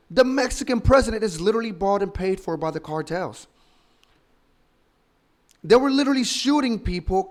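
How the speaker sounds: loud, high, heated rant; wild unhinged ranting. Real, not acted. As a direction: build to an outraged shout. Heated rant about cartels and president.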